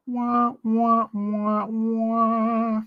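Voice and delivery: high, drawn-out wah-wah noises; high-pitched held wah sounds; mock wah-wah. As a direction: voice a string of high wahs. Spelt-out mock noise while musing.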